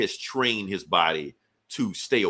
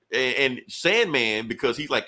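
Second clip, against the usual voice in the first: ordinary delivery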